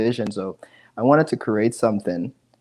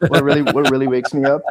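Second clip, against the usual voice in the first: laughing through the question, a bit higher and louder; 4 semitones higher, 7 dB louder than usual. Real, not acted. Asked what wakes him up, laughing as he repeats it. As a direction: chuckle out the words after your own joke. Laughs after joking answer.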